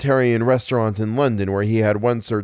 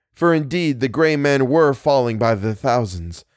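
usual speaking voice

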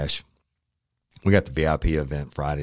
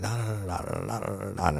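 higher nonsense syllables for a rant; mock scolding babble; 6 semitones higher than usual. Chief chewing out the officer, mock rant. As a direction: stand in for a chewing-out with da da. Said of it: "he was furious".

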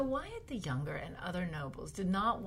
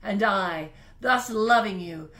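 big period-drama voice; 3 semitones higher, 11 dB louder than usual. Pretend. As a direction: declaim like a queen rallying her subjects. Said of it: "I'm gonna try to make a big voice like they do in period dramas".

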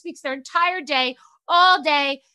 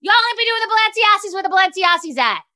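high, louder mock scold; 6 semitones higher, 6 dB louder than usual. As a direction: boss scolding an assistant. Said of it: "I'll be like".

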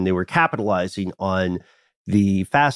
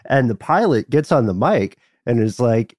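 ordinary talk, nothing marked

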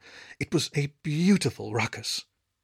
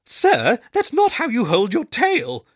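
much higher, louder, slow pup voice; young pup barking; 11 semitones higher, 7 dB louder, slower than usual. A narrator's reading. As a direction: call out cheekily like a young pup.

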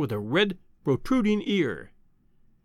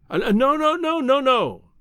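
high-pitched protesting cry; urgent cry; 9 semitones higher, 4 dB louder than usual. A narrator's reading. Visitor begging the professor not to end it, crying out. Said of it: "I cried".

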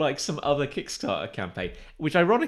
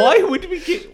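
high, loud incredulous shriek; hammed-up indignant scream; mock outraged yell; 11 dB louder than usual. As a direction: yell it in comic outrage, close to laughing. Repeats mock outrage at demand.

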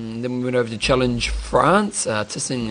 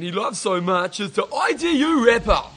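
a high, put-on Australian accent, hammed up; Aussie accent; 9 semitones higher than usual. Co-host teasing John with the segment intro, mock Australian accent. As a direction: play up a broad Australian accent for a laugh. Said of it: "I'm just doing my Australian accent".

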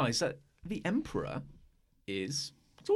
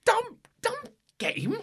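fuming at the silly game; seething. Acted.

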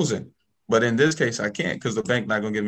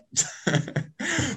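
high laugh; 5 dB quieter than usual. Real, not acted. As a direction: burst of high-pitched laughter. Laughs after retold punchline.

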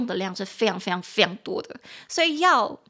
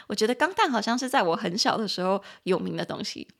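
normal talking voice

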